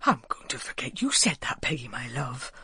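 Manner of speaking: put-on a dignified old villager, kept low; dignified old Walter. A narrator's reading. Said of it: "said Walter with dignity".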